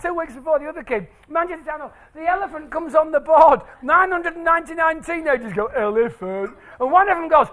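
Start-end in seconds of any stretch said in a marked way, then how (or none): 5.7-6.5 mimicking teenage chorus.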